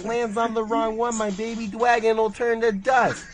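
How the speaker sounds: high, loud cartoon Brooklyn voice; Joey impression. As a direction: shout it like a cartoon duelist. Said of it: "Joey impression".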